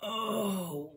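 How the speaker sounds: slow, moaning wake-up voice; groggy grumble. Acted. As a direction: groan it out, dazed. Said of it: "he grumbled groggily".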